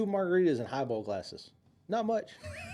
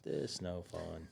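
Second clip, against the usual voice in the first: low, slow mock misery; a lightly voiced sad drinker; mock groan; 9 semitones lower, 12 dB quieter, much slower than usual. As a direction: drag it out like a worn-out drunk. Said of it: "You're like".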